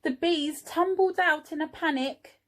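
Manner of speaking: ordinary delivery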